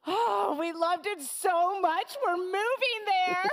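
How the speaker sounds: high, gushing phone chatter; excited gushing. Acted. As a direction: gush about the move. The woman excitedly talks on the phone.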